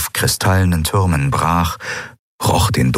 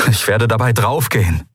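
higher-pitched, doomed objection; mild panic in a raised voice; agitated protest; 5 semitones higher than usual. Acted. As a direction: protest as if sure of dying. Frightened man argues with eidolon.

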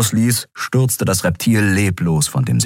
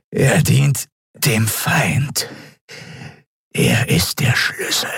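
slow, half-whispered reptilian voice; sinister Draxyl captive; slower than usual. A narrator's reading. Captive creature answering its interrogator, slow half-whisper. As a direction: answer slowly in an eerie, voiceless rasp.

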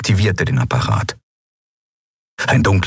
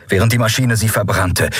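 ordinary delivery, nothing marked

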